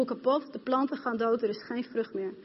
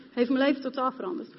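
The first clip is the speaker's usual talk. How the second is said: normal talking voice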